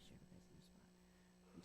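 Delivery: quick whispered side remark; whispered aside. Real, not acted. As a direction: whisper it under your breath. Quick aside during the introductions.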